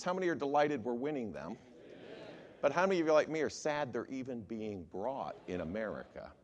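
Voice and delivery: ordinary talk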